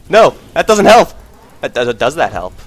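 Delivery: high, loud anguished yell; frustrated outcry. Real, not acted. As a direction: cry out at dying. Dies with gold.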